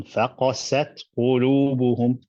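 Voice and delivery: faqasat qulubuhum slowly, with a clear stressed beat on faqasat; with nabr. Shown as a demonstration. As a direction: lean into faqasat, then a steady qulubuhum. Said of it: "I'm making a number on".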